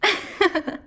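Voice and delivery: high breathy burst of demonic laughter; amused demon lord laughing at a sobbing mortal. Acted. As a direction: break into a delighted cackle at their crying. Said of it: "I just can't keep a straight face".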